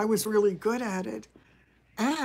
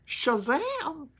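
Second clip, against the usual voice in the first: higher, drawn-out Southern "Shazam!" falling; Gomer Pyle catchphrase; 7 semitones higher than usual. Retold or imitated. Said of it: "Gomer Pyle".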